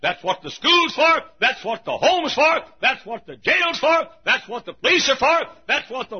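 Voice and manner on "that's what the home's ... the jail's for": normal talking voice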